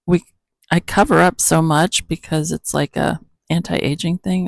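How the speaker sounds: ordinary talk, nothing marked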